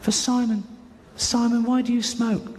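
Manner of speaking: high, drawn-out child impression; little girl's voice. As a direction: ask slowly like a little girl. Voicing little girl asking.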